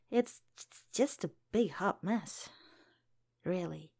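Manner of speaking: usual speaking voice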